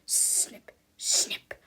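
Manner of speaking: sharp whispered snipping; crisp unvoiced effect; scissors sound effect. A narrator's reading.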